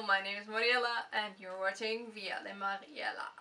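a rolled Spanish accent on the name Mariela; a Spanish-accented "Via de Mariela". Shown as a demonstration. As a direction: introduce yourself with a Spanish lilt. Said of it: "Why did I do that accent?".

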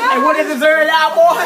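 high, loud hyped-up yell; hype shout. Real, not acted. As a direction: pump up the crowd.

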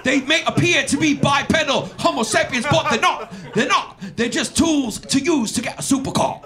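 high, loud hammed-up bro rant; Andrew Tate puppet. Comic voicing an Andrew Tate puppet, high and loud. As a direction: rant like a smug influencer puppet. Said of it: "My name's Andrew Tate".

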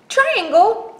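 very high, loud call; startled recognition. Acted. Square recognizing the trickster, high startled cry. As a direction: cry out in disbelief.

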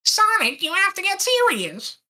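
high cartoon voice; squeaky serious plea; cartoon character voice. Quoting cartoon character line.

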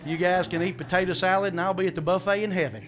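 normal talking voice

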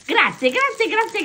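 high, loud burst of thanks; excited thanks. Real, not acted. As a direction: squeal your thanks with joy. Grandma thrilled by gifts.

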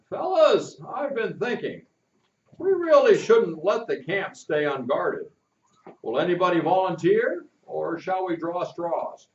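the captain promising a search tomorrow; kindly captain, planning. Acted. Captain voiced by the reader.

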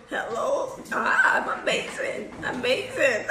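soft, partly voiceless, breaking into laughter; mock your own half-asleep interview voice; imitated sleepy English. Retelling her interview answers to friends, groggy and giggly. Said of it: "Aí eu".

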